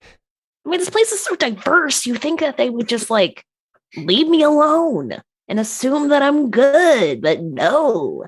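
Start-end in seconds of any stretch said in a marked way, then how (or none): 0.0-7.2 much higher exasperated voice; high-pitched complaint. Acted.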